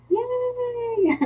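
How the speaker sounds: very high, drawn-out yay; playful cheer. Real, not acted. Cheering her own bookings, high playful yay. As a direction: squeal a long high cheer.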